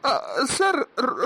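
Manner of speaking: a shaken stammer on hearing bad news; stunned stammer. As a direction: trip over the words in shock.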